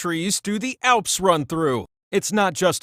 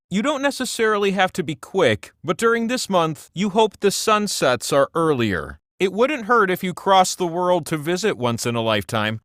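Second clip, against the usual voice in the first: ordinary talk, nothing marked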